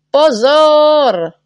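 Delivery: loud, high warning yell; one big, high-pitched holler; shouted warning. A narrator's reading. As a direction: shout it to clear the street. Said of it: "křičel na ně neználek".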